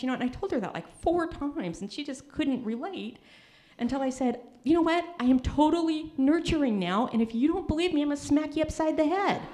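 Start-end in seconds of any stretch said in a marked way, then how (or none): 4.6-9.4 hammed-up motherly threat; mock threat. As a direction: bark it like a fed-up mom joking.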